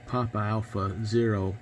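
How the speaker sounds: ordinary talk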